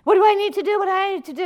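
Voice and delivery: high, loud desperate question; hammed-up panic, begging for instructions; frantic plea. Man on the cliff asking God, frantic high voice.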